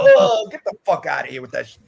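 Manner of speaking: mock dismissive quote. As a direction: scoff it like everyone reading that comic. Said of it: "and you go".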